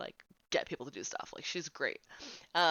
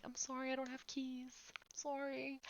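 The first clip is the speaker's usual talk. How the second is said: higher, much softer fake-sorry voice; sweetly unhelpful apology; 7 semitones higher, 13 dB quieter than usual. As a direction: apologize in a tiny sweet voice while stalling on purpose. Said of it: "I'm like".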